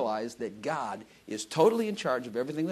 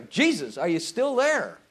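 higher, drawn-out plea; calling out to Jesus; 4 semitones higher, 5 dB louder, slower than usual. Pretend. Believer in the fire calling to Jesus, high pleading call. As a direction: call up as if he might not be there. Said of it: "hope he's there".

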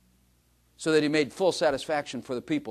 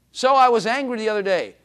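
higher, louder mock self-justifying voice; hammed-up excuse from a churchgoer; mock excuse-maker; 7 semitones higher, 8 dB louder than usual. Mocking a self-excusing believer.

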